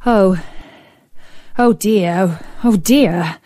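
fretful, worried oh dear; a hint of a frightened prince; nervous prince. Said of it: "shaking with fear".

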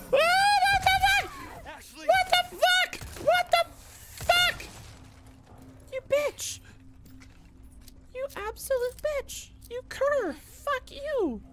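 mock-furious high-pitched betrayal; high outraged character voice. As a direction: rage in a squeaky put-on voice.

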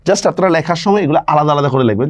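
ordinary talk

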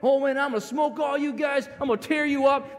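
put-on high, loud boastful kid voice; mock big-talking youngster; trash-talking kid. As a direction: brag like a cocky kid. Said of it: "that kid who's talking about that".

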